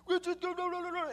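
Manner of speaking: loud, high gibberish patois tirade; mock angry rant. Stepfather mad at guests staying so late, loud mock rant. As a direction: burst into an imitated angry Jamaican rant. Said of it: "he gets mad and says".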